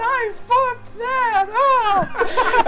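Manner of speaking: high, very slow drawn-out wail; mock cartoon wail. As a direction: howl at the botched summon. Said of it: "and he's like".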